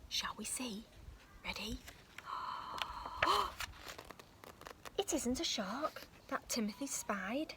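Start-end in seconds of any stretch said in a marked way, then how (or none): 2.2-4.2 sharp rising breathy gasp; storyteller gasping at the runaway pram; high whispered gasp. Acted. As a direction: gasp in surprise at the reveal. Reader builds suspense.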